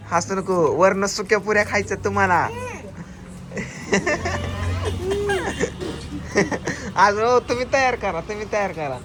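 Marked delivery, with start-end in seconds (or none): 2.5-6.9 laughing helplessly; high giggling fit; real laughter; long high laugh. Real, not acted. Kids laughing during challenge.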